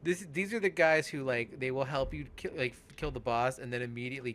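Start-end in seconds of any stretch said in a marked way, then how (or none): none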